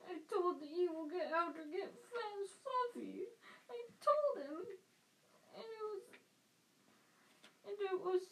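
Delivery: high, breaking crying voice; sobbing giant confessing his mistake, voice cracking high. A narrator's reading. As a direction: wail it through tears.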